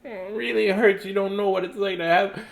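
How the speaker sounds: high whiny bird voice; mock crying. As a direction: whimper like a hurt bird. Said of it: "Bird kept crying".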